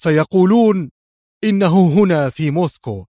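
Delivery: loud, high, nervous burst; agitated. A narrator's reading. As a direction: blurt the rumour with agitation. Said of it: "قبل أن يتابع في عصبية".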